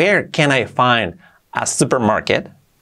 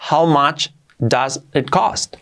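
How much does it cost? said slowly, each word clear; slow, careful teacher read of How much does it cost?; slow model sentence; 2 semitones higher, slower than usual. Shown as a demonstration. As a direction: spell it out slowly for learners. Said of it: "Lo correcto sería".

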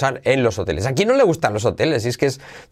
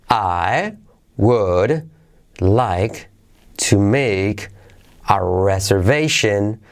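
slow, separated words with long pauses; 2 semitones lower, much slower than usual. Shown as a demonstration. Teacher modelling a booking sentence for learners, word by word. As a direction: say each word on its own, slowly. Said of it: "Vamos a ver palabra a palabra".

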